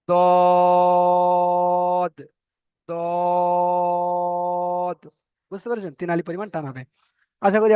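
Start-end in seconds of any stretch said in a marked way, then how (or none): 0.1-5.0 দদ held long between দ and জ; drawn-out dwad. Shown as a demonstration. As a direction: model দদ slowly for the learners. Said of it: "এখানে আপনি উচ্চারণ করবেন". (দ-জ মাঝামাঝি লম্বা টানে দদ)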